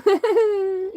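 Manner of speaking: loud, high laugh; high burst of laughter. Real, not acted. Laughing hard at the balls joke. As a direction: let out a big laugh.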